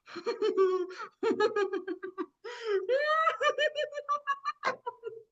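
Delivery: long high giggle rising to a squeal; delighted laughter. Acted. Boo Boo enjoying her new trunk, high delighted laughing.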